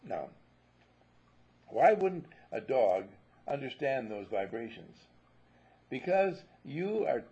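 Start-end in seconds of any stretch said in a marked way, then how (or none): none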